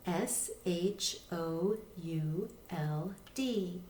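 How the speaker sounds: slow, letter by letter; teacher spelling a word aloud. Shown as a demonstration. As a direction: spell each letter slowly and clearly. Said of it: "spelling letter by letter".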